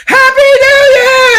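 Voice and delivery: high, loud shouted greeting; screamed greeting. Retold or imitated. Lady screamed on quiet plane.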